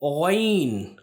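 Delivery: गैन sounded from the throat; letter demo. Shown as a demonstration. Teacher introduces the letter to students, careful letter demo. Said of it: "अगला जो हर्फ है वो है".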